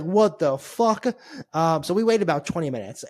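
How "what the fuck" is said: slow, drawn-out what the fuck; stunned disbelief. Retold or imitated. Ignored by the waiter at the airport, slow stunned disbelief. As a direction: let it hang in disbelief. Stunned at being refused service.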